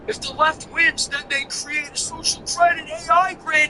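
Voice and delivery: high put-on voice parodying a conspiracy rant; mock paranoid voice. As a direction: whine it like a paranoid fan. Parodying a paranoid super chat.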